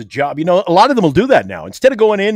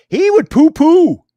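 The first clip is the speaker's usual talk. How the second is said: mock poo-pooing; 10 semitones higher, 7 dB louder than usual. Mocking friend's dismissive attitude.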